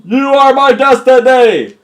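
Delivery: slow, high, loud holler; hammed-up declaration; mock-dramatic yelling. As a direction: belt it out with drawn-out words. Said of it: "I have to yell".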